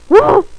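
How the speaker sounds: sudden loud, high gasp; short high burst of mock craziness; crazy gasp. Acting crazy while stuck waiting, loud high gasp.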